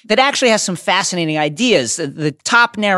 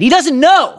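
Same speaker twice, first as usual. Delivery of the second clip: gushing; 11 semitones higher, 6 dB louder than usual. Pretend. Gushing over Fish Guy romance.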